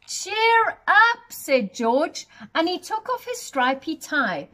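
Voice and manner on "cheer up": much higher, louder bright encouragement; cheerful. Acted. Giant comforting the giraffe, high loud cheerful call.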